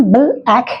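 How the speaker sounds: "बल आँख" as bl-ack, closing on the l; correct pronunciation. Shown as a demonstration. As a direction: hold the l in "बल", then add "आँख" as ack. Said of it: "शुद्ध उच्चारण".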